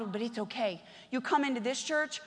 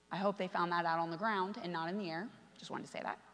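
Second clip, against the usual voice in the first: low, soft wry aside; quiet joking aside; 7 semitones lower, 9 dB quieter than usual. Real, not acted. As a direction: drop your voice for a little joke.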